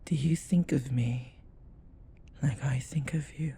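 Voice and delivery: softening into a hushed whisper; fading to a whisper. Acted. Demon asking his summoner for love, fading whisper. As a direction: let the question sink to a whisper. Intimate question.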